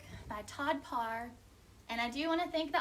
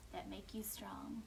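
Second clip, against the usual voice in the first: tender whisper, softer; soft reassuring storytime whisper; 5 semitones lower, 11 dB quieter than usual. Acted. Whispered encouragement.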